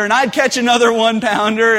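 ordinary delivery

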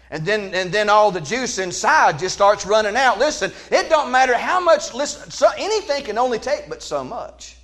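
high, rising preacher build; preaching at full force. Acted. Preacher rallying the congregation, high and lively. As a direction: lift it up with a laugh.

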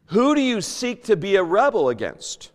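ordinary talk, nothing marked

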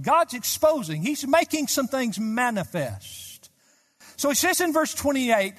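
impassioned preacher pressing the point home; rising preaching. Acted. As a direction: build the sermon into fervent urgency. Preaching at full force.

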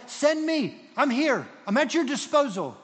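high, loud, fervent plea; volunteer with full voice; eager volunteering. Retold or imitated. Servant offers himself to God like Isaiah, eager volunteering.

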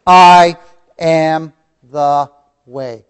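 I said loud, then am the way drawn out slowly; slow emphatic quote. Shown as a demonstration. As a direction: lean on I, then slow the rest right down. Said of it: "One syllable words".

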